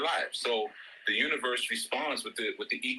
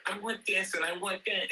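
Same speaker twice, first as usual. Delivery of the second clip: put-on whiny little boy; 7 semitones higher than usual. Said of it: "starts to sound like that in her ear".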